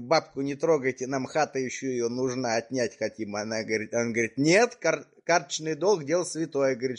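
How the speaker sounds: usual speaking voice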